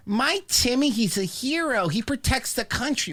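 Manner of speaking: high, gushing parent impression; mock proud parent. Parent brags about a son in the TSA, mocking pride. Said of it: "Do you think parents go around".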